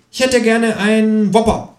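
high, slow, prim kangaroo ordering. Acted. Kangaroo orders a Whopper at McDonald's, high and slow. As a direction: order politely in a high put-on voice. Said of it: "sagt das Känguru".

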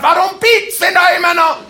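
high, loud frustrated shout; retold shout at a car that keeps beeping; yelling. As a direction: bellow it in exasperation. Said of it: "Und ich hab brüllt ohne Ende".

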